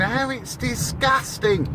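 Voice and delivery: high, slow, sneering posh home counties voice; prissy put-on home counties tone, drawn out; mock home counties accent. Mocking middle-class radio callers in a high home counties accent. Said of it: "sort of put on home counties accent".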